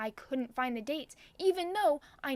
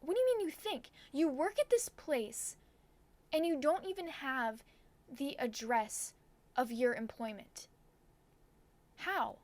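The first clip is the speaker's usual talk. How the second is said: incredulous; 3 semitones higher than usual. Retold or imitated. Customer questions cashier who doesn't know the address, incredulous. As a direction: question it in exasperated amazement, pitch jumping up.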